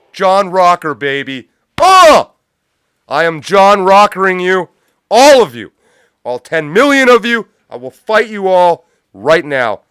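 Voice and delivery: loud, high, cocky challenge; trash-talk shouting; taunting yell. Real, not acted. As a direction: scream the taunt at a whole city. Taunting New York, yelling.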